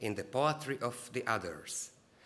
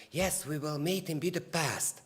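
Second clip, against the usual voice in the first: higher-pitched mock movie line; put-on higher voice, "Budapest" with plain s; quoted film dialogue; 5 semitones higher than usual. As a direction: lift the voice to imitate a film character. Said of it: "and the dialogue was".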